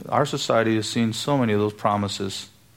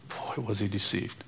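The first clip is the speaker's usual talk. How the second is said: quiet aside; 10 dB quieter than usual. Real, not acted. Sardonic aside.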